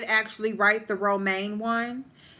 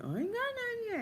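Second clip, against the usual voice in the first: high squeaky mimic; 13 semitones higher, 9 dB quieter, faster than usual. Mocking Queen Diva's repeated excuse in a high squeak. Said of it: "Queen Diva like".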